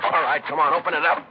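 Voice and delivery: loud, high bark at the door; shouting orders. Acted. Cop at the door of the suspect's pad, shouting. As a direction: pound out the command to open up. Cops raiding the house.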